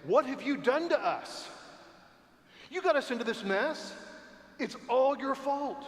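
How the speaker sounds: high, loud whining blame; hammed-up grumbling crowd accusing Moses; mock angry complaint. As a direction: pretend accusing outburst. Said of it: "turn to Moses and say".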